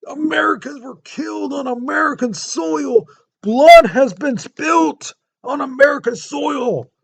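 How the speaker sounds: high, loud outraged shout, slowing at the end; outraged yelling. Retold or imitated. President Polk to the press and Congress, furious shout. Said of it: "He's yelling".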